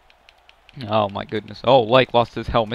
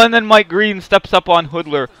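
ordinary delivery

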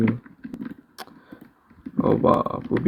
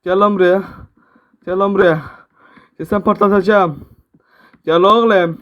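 taunting yell; 6 semitones higher than usual. Real, not acted. Gamer yelling taunts at the enemy player. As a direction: call the enemy out, fired up.

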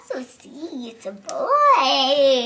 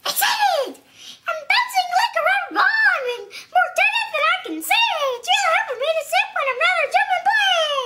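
higher, louder, bouncing with giggles; excited; 13 semitones higher, 6 dB louder, faster than usual. Acted. Child reading the excited page in a squealing voice. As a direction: burst out like you can't sit still. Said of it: "a very funny voice for each tone".

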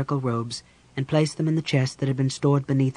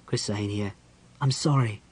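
soft, lowered apology; tender apology; 4 semitones lower, 4 dB quieter than usual. A narrator's reading. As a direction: drop the voice and apologize gently. Caramon apologizes after the argument.

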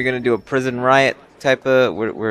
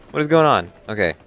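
ordinary talk

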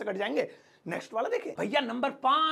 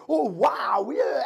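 mock English awe; 4 dB louder, much slower than usual. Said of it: "अंग्रेजों को देखा है".

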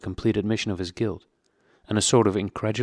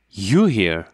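sudden startled question, louder; 6 dB louder than usual. A narrator's reading. Prisoner startled to see Parsons, a startled blurt. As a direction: blurt it out in shock. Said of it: "startled into self-forgetfulness".

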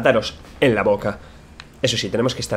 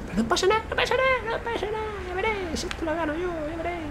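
high squeaky voice; 10 dB quieter than usual. Pretend. High voice, reassuring himself.

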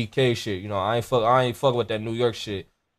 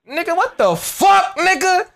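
outraged disbelief; 13 semitones higher, 4 dB louder than usual. Acted. Outraged at New York flavor.

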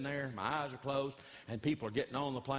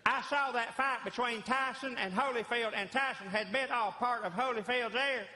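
Ross Perot; 10 semitones higher than usual. Retold or imitated. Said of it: "I went right into Ross Perot".

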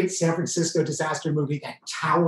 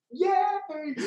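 high squealing yay; excited cheer. Real, not acted. Celebrating booked Hawaii vacation, excited yay. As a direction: burst out with glee.

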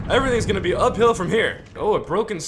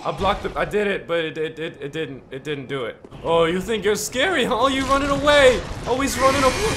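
ordinary delivery

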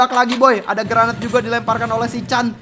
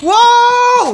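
excited shout; 9 dB louder than usual. Real, not acted. Triple kill on screen.

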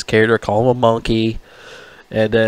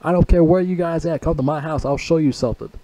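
talking tough; 6 semitones higher than usual. Retold or imitated. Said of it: "Denzel Washington was talking shit".

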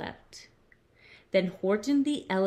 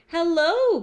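high, loud sing-out hello; lazy bird's loud, high greeting; calling hello; 8 semitones higher, 7 dB louder than usual. A narrator's reading. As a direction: call out brightly to a passer-by. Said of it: "called the lazy bird, smiling her best".

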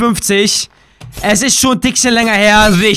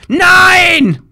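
high, loud anguished cry; real frustrated howl; crying shout; 6 semitones higher, 3 dB louder than usual. Real, not acted. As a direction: wail at the failed throw. Balls thrown too far again.

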